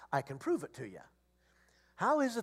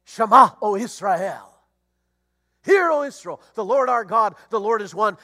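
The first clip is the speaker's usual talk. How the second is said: solemn call to worship; 2 semitones higher, 12 dB louder than usual. Retold or imitated. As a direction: intone it like an ancient call to prayer. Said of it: "Israel called itself to worship this way".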